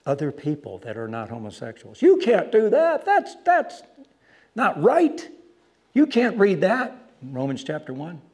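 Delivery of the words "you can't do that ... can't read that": high, loud mock outrage; pitched-up retold protest, loud; upset objectors. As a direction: imitate indignant people protesting. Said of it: "guess who got upset".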